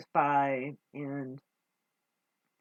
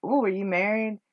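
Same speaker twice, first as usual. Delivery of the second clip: a high, louder sing-song mock of the question; mocking quote; 4 semitones higher, 4 dB louder than usual. Mimicking the man who hit on her at work, mock coy. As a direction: make his question sound silly. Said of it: "Just like".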